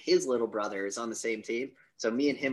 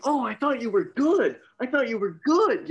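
high, louder sing-song mockery; sarcastic jeer at the kid; mocking taunt; 10 semitones higher, 5 dB louder than usual. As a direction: rub it in that he isn't good. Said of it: "he's like".

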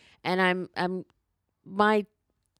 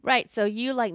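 ordinary talk

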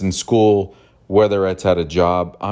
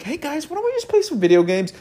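put-on much higher, sweet child's plea; little kid voice. Child stepping between fighting adults, high kid voice. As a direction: play a seven-year-old calming the adults. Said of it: "I said it just like that at seven".

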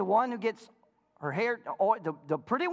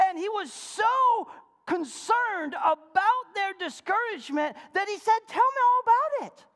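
preaching at full force; 10 semitones higher, 3 dB louder than usual. Real, not acted. Preacher rousing his congregation, high and loud. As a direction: swell it up like a revival call.

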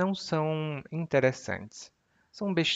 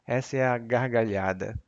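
ordinary talk, nothing marked

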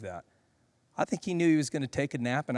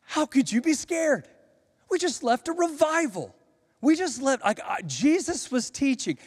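voicing Jesus; 9 semitones higher, 4 dB louder than usual. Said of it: "He says".

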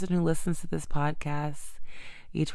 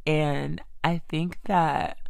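ordinary talk, nothing marked

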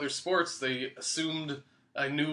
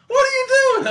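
very high, loud yell; shouting coach; 11 dB louder than usual. Retold or imitated. Coach runs up to collapsed runner, shouting. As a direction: imitate a coach screeching in disbelief.

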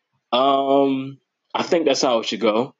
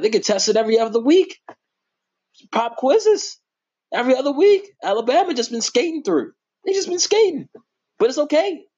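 high-pitched, worked-up mocking rant; excited rant; 7 semitones higher than usual. Real, not acted. Mocking Alabama's schedule to viewers, high excited rant. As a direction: rant higher and higher about the tests.

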